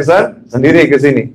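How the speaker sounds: ordinary delivery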